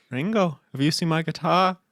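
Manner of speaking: put-on lilting Liverpool accent, innocent question. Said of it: "I just started doing the Beatles".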